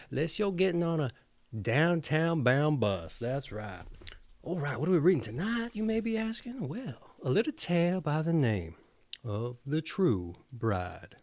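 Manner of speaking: ordinary delivery, nothing marked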